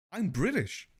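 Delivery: playful fake British accent; joking British accent; mock British accent. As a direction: put on a posh British voice for a laugh. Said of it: "This is totally a real accent".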